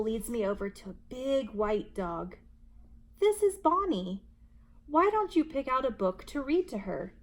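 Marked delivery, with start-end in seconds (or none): 3.2-7.1 higher, louder friendly librarian. A narrator's reading. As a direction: present the dog cheerfully. Librarian introduces the dog.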